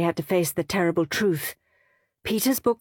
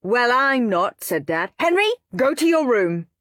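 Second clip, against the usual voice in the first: louder, stern father; 6 semitones higher, 4 dB louder than usual. A narrator's reading. Dad sending Henry to his room, stern. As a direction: lay down the law.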